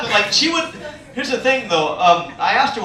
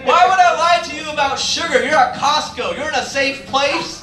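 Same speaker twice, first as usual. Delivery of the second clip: higher, incredulous protest; acted indignant comeback; exasperated; 6 semitones higher than usual. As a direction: plead your case in exasperation, pitched up. Exasperated argument with customer.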